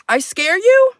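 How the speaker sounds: loud teasing challenge; mock-tough taunt.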